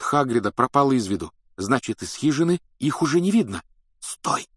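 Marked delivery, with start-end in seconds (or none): 4.0-4.5 sharp voiceless command; whispered order. A narrator's reading. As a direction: whisper a halt to your companions. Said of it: "шёпотом приказал он".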